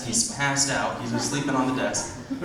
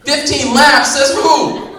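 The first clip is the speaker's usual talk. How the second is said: very high, loud squeal of outrage; outraged at the clerk's demand; shocked indignation; 14 dB louder, much slower than usual. Acted. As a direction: squeal it in disbelief.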